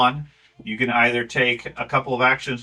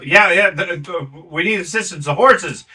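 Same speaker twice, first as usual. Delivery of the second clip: high, loud worried gnome; a little gnome begging for help; 6 semitones higher, 6 dB louder than usual. Acted. GM voicing the gnome.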